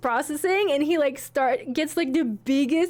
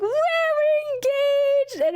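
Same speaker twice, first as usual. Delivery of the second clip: much higher and slow, drawn out with joy; excited exclaim; 13 semitones higher, 3 dB louder, slower than usual. Retold or imitated. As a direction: stretch it out like you can't believe it. Both exclaim after the proposal.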